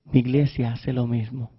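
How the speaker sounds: soft and low, a quiet voice of God; God quietly lamenting his people, hushed and low; God speaking softly. Acted. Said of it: "Y Dios me decía".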